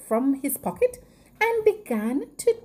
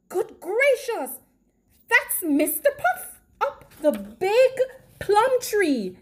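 high-voiced, astonished exclamation; 8 semitones higher than usual. A narrator's reading. Missus Puff is astonished.

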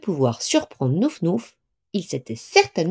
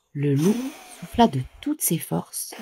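ordinary delivery

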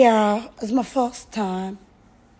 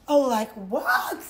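higher, drawn-out incredulous reaction; mock disbelief; 6 semitones higher, slower than usual. Woman retelling her shock at Lekki guys, amused disbelief. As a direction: act out being taken aback, half laughing.

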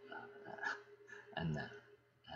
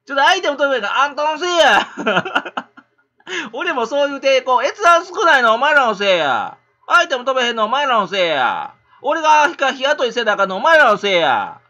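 much higher and louder mock rant, half laughing; hammed-up mock blaming rant; mock whiny streamer; 8 semitones higher, 10 dB louder than usual. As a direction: parody a streamer blaming viewers. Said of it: "みんなそう、同じこと言うて".

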